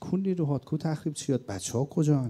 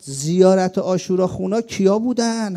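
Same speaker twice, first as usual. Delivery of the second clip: higher, louder, slow shouted call; retold battle cry; 7 semitones higher, 8 dB louder, slower than usual. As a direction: reenact a young fighter's cry to rally the battalion. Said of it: "یه دفعه داد زد". (فریاد بلند و کشیده)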